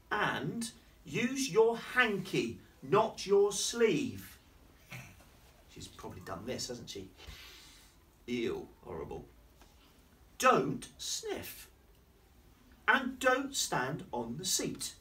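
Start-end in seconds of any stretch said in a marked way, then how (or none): 0.1-4.4 very slow, higher, firm; slow scolding. Acted. Baby voice scolding Lulu, slow and prim. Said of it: "she was going". 10.4-14.9 higher, slow telling-off; prim scolding voice. Acted. Baby scolding Lulu, higher and slow. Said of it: "she was going".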